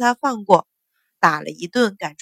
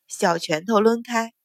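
ordinary delivery

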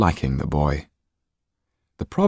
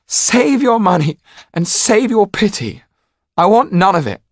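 much higher, louder tearful shout; 7 dB louder than usual. A narrator's reading. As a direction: yell at them through tears. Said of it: "Jan was shouting now".

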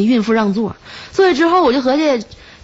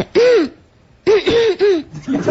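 hummed cough sounds, pitched up with a laugh; imitated cough; 9 semitones higher, slower than usual. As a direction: fake a nagging little cough. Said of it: "就这么咳嗽". (模仿咳嗽声，带点笑)

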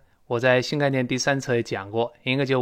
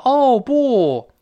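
much higher and louder, hammed-up dismay; mock awkward exclamation; 11 semitones higher, 7 dB louder than usual. Teacher asks who talks like this, hammed-up awkward exclamation. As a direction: overplay it like badly translated dialogue. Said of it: "这个别扭啊".

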